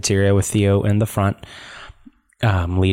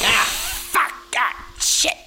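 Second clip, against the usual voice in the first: Underpants Gnomes. Acted. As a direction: curse in bursts, pitched well up. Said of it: "you sound like the underpants gnomes".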